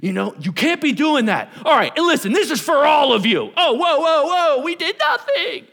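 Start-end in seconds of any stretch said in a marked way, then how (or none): 0.4-3.5 loud, high scolding; mad dad. Acted. Dad mad at older brother turns on everyone, angry scolding. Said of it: "dad's, like, mad at your older brother". 3.6-5.6 very high, loud, stretched-out protest; protesting kid. Acted. Younger kid dragged into dad's scolding, loud protest. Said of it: "somehow you became part of the conversation".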